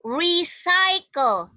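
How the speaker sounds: Recycle stretched out very slowly, ree-sai-kel; slow dictation. Shown as a demonstration. Teacher gives the class one last tricky word, slow dictation. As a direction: dictate Recycle clearly with long syllables.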